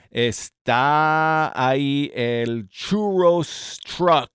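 slow, loud drawn-out announcement; announcer drawl. Acted. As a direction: stretch out the announcement. Announcing the food truck.

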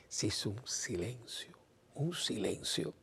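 a slow, breathy whisper telling of the silence; hushed whisper. A narrator's reading. The priest whispers to his congregation about the silence. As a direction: let the silence hang in a hush. Dramatic hush after the reproach.

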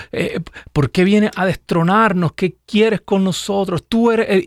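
a hint of frightened demons pleading; mild mock demon wail; demons crying out. Demons cry out to Jesus.

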